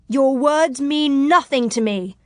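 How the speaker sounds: high, slow, emphatic declaration; defiant cry. Acted. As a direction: cry it out to dismiss the enemy. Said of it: "she cried".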